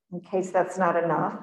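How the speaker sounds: ordinary talk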